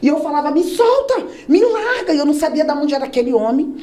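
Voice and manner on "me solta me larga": high, pleading struggle; high protest. Retold or imitated. Girl being dragged out of the dance, protesting high. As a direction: plead to be let go.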